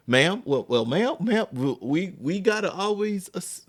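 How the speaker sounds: retold sputtering attempt to cut in; flustered officer. Acts out flustered officer interrupted by mom.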